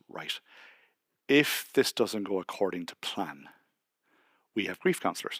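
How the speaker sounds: a hint of a hushed, grave midwife; soft solemn midwife. Midwife warning the couple about grief counselors, softly solemn.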